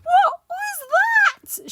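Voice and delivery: very high, loud, drawn-out shriek; terrified shriek. Acted. Mister Jelly hears a snore, terrified shriek. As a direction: shriek it out in sudden panic. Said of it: "shrieked Mister Jelly".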